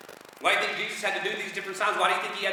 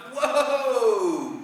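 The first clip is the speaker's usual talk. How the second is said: high, loud, drawn-out whoa; mock alarm, stretched long and falling; startled demons; 7 semitones higher, 6 dB louder than usual. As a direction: cry out as demons taken aback. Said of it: "And they're like".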